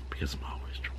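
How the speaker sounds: whispered aside. Pretend. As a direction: whisper the punchline. Whispered punchline.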